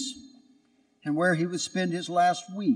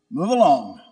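louder curt command; curt order; 3 semitones lower, 6 dB louder than usual. Acted. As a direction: brush the donkey off. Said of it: "ordered him".

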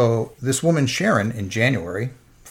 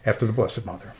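holding back tears; 5 semitones higher, 8 dB louder than usual. Real, not acted. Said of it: "I'll try not to cry".

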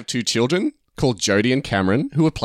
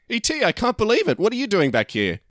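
high-pitched, acted disbelief; amazed greeting; 11 semitones higher than usual. Elliot greets the returned alien, acted high-pitched amazement. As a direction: start very high, easing down in amazement.

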